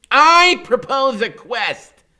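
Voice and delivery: high, loud, slow knightly call; grand proclamation. A narrator's reading. Freak proposing a quest, high slow and loud. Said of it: "he says".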